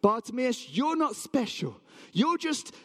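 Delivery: high, emphatic put-down; scornful berating. Acted. Townspeople tell Bartimaeus he is nobody, scornful berating.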